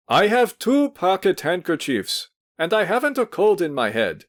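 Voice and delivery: calling after. A narrator's reading. Pavel protesting to the hostess as she leaves, called out. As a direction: call out to the departing lady. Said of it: "called after her".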